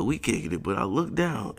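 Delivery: ordinary talk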